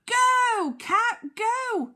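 high, loud urging cry; shout. Acted. Teacher reading Duck as cod gets free, urging shout. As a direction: shout it out with drawn-out "go". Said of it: "And Duck shouts".

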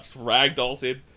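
ordinary talk

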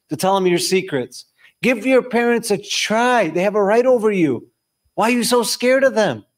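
high-pitched, pressing exhortation; impassioned preaching. Real, not acted. Preacher urging listeners to stop being cowards, impassioned preaching.

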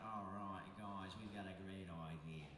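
low, soft Aussie voice; mock Australian promoter pitching an idea; Australian accent. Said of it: "and they say".

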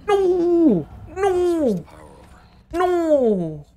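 high, loud despairing cries; desperate no. Real, not acted. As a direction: cry out in despair. Companion dying.

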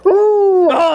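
loud, high burst of delight; thrilled high shout; excited cry. Real, not acted. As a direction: yelp with joy as the dog howls. Dog finally howls.

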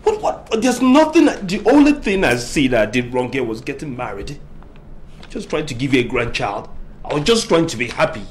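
high pained cry; anguished outburst. Acted. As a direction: pour out the hurt loudly.

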